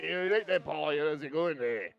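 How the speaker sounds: high, loud, slow slurred Scottish greeting; mock Scottish man who sounds drunk; mumbled Scottish. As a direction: mumble it like a tipsy Scot. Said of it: "Like a mumbo".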